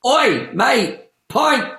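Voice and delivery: loud, high British pub shout; British pub accent. Shown as a demonstration. Visitor ordering at a British pub, loud broad accent. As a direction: call for a drink in broad EastEnders British. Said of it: "how to order a pint".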